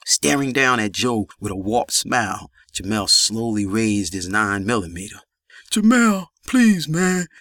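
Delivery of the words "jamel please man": high, louder plea through pain. A narrator's reading. Wounded Joe begging Jamel at gunpoint, high pained plea. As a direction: beg for your life in a raised, hurting voice. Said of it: "slurred from the pain".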